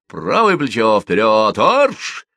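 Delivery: shouting drill sergeant. Acted. Drill command on the march.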